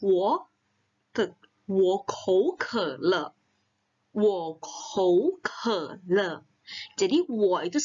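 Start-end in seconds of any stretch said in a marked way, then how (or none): 0.1-6.4 "Wo kou ke le" spoken very slowly, each syllable apart; slow model sentence. Shown as a demonstration. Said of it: "Makanya kita bilangnya". (ucapkan "Wo kou ke le" sangat pelan)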